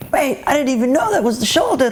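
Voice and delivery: hammed-up shocked friend; startled realization. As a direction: caught off guard. Ewan realizing his driving mistake.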